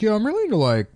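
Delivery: high-pitched mock fanboy voice; exaggerated high-pitched questioning gush; mocking zombie fans. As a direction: squeal up high on the key words, mocking an over-keen fan.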